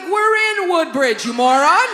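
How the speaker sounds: loud, high, drawn-out retort; exasperated comeback. Retold or imitated. Woman correcting the driver's insult, exasperated loud retort. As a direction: stretch it out as for an idiot.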